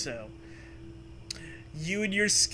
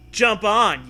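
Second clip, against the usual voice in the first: louder call to hop aboard; 5 semitones higher, 10 dB louder than usual. Acted. Kid calling to Zoe to hop on, loud. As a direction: call her onto the board. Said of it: "you tell her".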